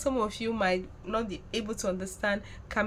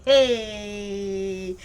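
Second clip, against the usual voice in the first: mock cheer; 4 dB louder than usual. Gloating at South Africans.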